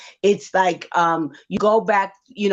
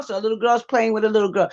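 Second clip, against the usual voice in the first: normal talking voice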